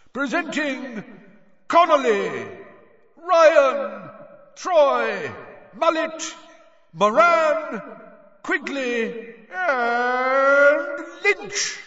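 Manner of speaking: high, loud booming announcer voice; stadium announcer. A narrator's reading. Bagman presenting the Bulgarian team, booming announcer. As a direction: announce them to the whole stadium. Announcer calls Irish players.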